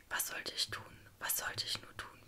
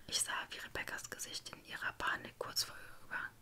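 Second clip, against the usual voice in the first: ordinary delivery, nothing marked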